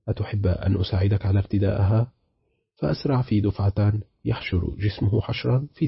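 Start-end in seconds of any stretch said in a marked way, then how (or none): none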